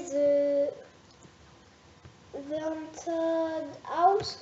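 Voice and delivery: slow, halting English title in a French accent; bad English accent. Real, not acted. French vlogger drags out an English title in her poor accent. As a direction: stumble through the English title. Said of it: "mon accent d'anglais pourri".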